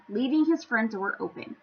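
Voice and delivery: plain reading voice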